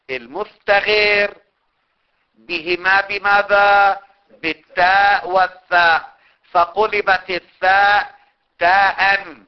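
loud, slow, spelled-out dictation. Acted. Teacher dictating to students, loud and slow. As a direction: read each phrase slowly so students can write.

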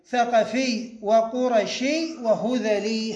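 ثقفي وقرشي وهذلي slowly, long final ī on each; demonstrated nisba forms. Shown as a demonstration. Teacher showing irregular nisba forms, slow careful demonstration. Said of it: "هكذا".

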